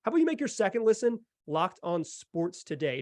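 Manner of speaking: ordinary talk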